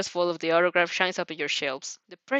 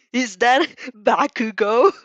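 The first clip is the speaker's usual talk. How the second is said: laughing loud and slow through the name; cackling; 3 semitones higher, 7 dB louder, slower than usual. A narrator's reading. Friend cackling at a childhood photo, laughing loud. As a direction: break into a cackle mid-question. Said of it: "He cockles".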